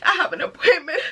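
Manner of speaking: high, tearful mock sob on the phone; retold crying call. To the clinic receptionist, high crying plea. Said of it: "I've called them and I'm crying".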